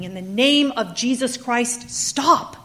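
loud, slow, high pleading yell; screaming. Acted. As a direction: plead loudly for it to stop. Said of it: "Telemachus is screaming".

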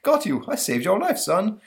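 high, loud cartoon monster; proud rescue line. Monster catching the boy, high and pleased.

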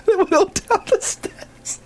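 high giggly voice, words slowed by laughter; can't hold the laugh back; laughing as she describes the paint on the stairs. Real, not acted. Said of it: "That's not funny".

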